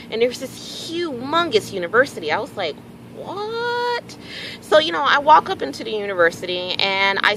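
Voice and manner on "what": high squeaky gasp of surprise; a startled, much higher what; stunned at the sight; shocked what. Retold or imitated. Shocked at huge university.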